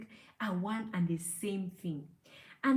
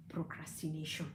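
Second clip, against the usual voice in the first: whispered word; 6 semitones lower, 10 dB quieter than usual. Real, not acted. Counselor whispering the downfall to viewers, hushed. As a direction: drop to a soft whisper on procrastination.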